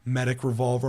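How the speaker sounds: normal talking voice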